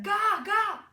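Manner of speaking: put-on high, loud baby squawk; baby's insistent cry. A narrator's reading. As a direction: call it out like a demanding infant. Baby raven crying out in story.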